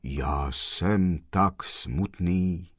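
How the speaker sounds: low, slow and grave, like from a tomb; sepulchral voice. A narrator's reading. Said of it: "povídal hrobovým hlasem".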